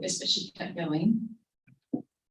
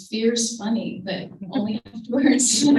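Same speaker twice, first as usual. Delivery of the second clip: normal talking voice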